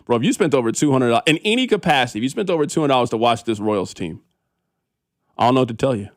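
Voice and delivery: ordinary talk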